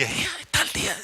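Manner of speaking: breathy, unvoiced whisper; whispered worry. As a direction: whisper it as a nervous aside.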